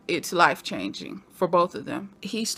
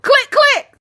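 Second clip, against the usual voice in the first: very high, loud mimicked command; mock yell; 10 dB louder than usual. Repeating the episode's favorite line, high mock yell. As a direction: shout both words in gleeful imitation.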